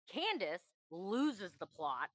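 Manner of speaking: usual speaking voice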